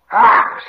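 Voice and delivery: loud frightened creature shriek; sudden angry, fearful screech; hissing shriek. Acted. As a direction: shriek with a rasping hiss. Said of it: "hissing shriek".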